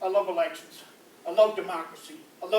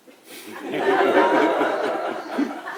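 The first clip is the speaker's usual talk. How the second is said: big laugh; 6 semitones lower, 8 dB louder than usual. Real, not acted. Comic laughing at own joke.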